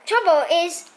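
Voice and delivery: usual speaking voice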